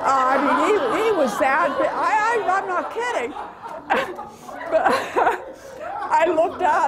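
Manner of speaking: can barely get it out for laughing. Real, not acted. Grandmother laughing while telling the family about the giant. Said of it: "I'm either laughing or crying".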